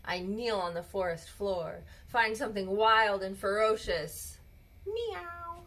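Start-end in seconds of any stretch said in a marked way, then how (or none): 4.9-5.6 high, soft little meow; cat meow. Acted. Mother finds a cat under the bed, soft meow. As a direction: mew like a kitten under the bed.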